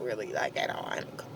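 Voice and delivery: muttered under breath, very soft; quiet aside. Real, not acted. Admitting to the viewers quietly she did not cuss, softly. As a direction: drop it to a murmur.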